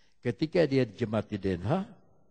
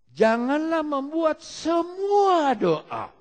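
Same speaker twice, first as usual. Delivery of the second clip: slow, high, loud exhortation; forceful preaching; 14 semitones higher, 9 dB louder, much slower than usual. Real, not acted. Preacher warns congregation about prayer, full force. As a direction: stretch and lift the warning for the whole hall.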